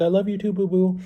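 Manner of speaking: high sweet lovey-dovey; sweet reply. Retold or imitated. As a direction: coo it back sweetly. Girlfriend replies sweetly.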